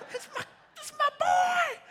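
high loud proud shout; joyful shout. Retold or imitated. Dad shouting back at the doctor, overjoyed. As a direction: yell it out, overjoyed. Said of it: "And I'm like".

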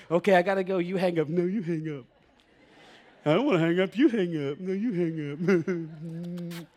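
sweet, giggly lovebird voice; giggly late night call. Retold or imitated. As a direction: play a teen sweetheart who won't hang up. Teen lovers on the phone.

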